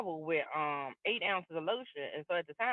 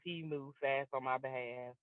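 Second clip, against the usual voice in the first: slower and softer, every word weighed; slow emphatic repeat; 3 semitones lower, 7 dB quieter than usual. Shown as a demonstration. Testifying to the audience about God, slow and soft emphasis. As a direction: let "he moved fast on my behalf" sink in slowly. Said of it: "when I say".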